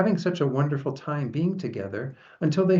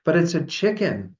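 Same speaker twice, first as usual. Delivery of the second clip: higher-pitched, insistent storybook protest; 6 semitones higher, 4 dB louder than usual. A narrator's reading. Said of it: "they protested".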